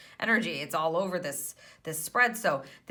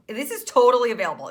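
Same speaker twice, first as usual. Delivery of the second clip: excited; 5 semitones higher, 9 dB louder, much faster than usual. Real, not acted. Excited tarot reading.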